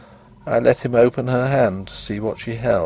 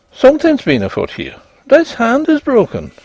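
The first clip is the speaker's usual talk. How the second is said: higher and louder, rising alarm; alarmed discovery; 12 semitones higher, 5 dB louder than usual. A narrator's reading. Doctor finds the statue's broken hand, higher louder alarm. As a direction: sound the alarm as you find it.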